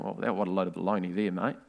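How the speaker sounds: quick, dismissive scoff; scoffing dismissal. Retold or imitated. The Sadducees sneering at Jesus' claim, a quick mock scoff. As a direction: wave it off as nonsense. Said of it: "pulling up their noses saying".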